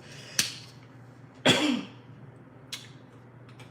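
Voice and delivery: long rasping coughing fit; choking cough. Real, not acted. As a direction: keep coughing, still choking. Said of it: "it went down the wrong".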